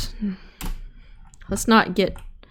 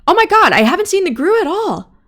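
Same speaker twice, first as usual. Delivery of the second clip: excited; 10 semitones higher, 6 dB louder than usual. Real, not acted. Surprised relief, no monster seen.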